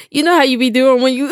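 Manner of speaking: ordinary delivery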